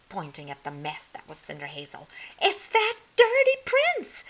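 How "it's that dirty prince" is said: high and loud, squealing stepsister; stepsister crying out. A narrator's reading. Hermione spots dirty Cinder Hazel, high loud cry. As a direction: shriek it like a silly witch sister. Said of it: "cried her stepsister Hermione".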